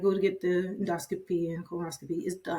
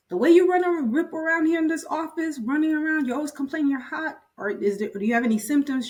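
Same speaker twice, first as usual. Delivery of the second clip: high, louder disbelieving boss voice; incredulous doctor; 9 semitones higher, 5 dB louder than usual. Retold or imitated. Doctor tells her busy nurse she can't be anemic, incredulous. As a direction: rattle off why it can't be true.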